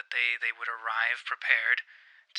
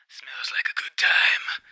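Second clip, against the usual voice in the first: breathy growl from the dark; low growling voice; 3 dB louder than usual. Retold or imitated. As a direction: whisper it like a lurking beast. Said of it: "I hear in a low, growling voice".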